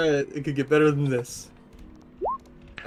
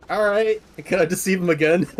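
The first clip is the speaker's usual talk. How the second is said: eager; 5 semitones higher, 4 dB louder than usual. Acted. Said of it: "I look at him going like".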